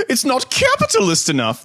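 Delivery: very high squeaky put-on voice; hammed-up squeaky complaint; anarcho-capitalist Jordan Peterson parody. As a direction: squeak it out as a mock pundit. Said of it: "anarcho-capitalist reviews the Ford".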